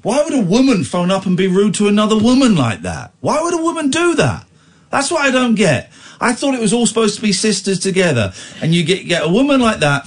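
high-pitched indignant appeal; indignant bewilderment. Real, not acted. Host asking why a woman insults another, indignant. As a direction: protest it, voice pushed right up. Mock outrage over rude caller.

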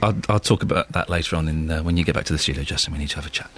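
quiet, low mock warning; mock stern. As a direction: sink into a mock threat.

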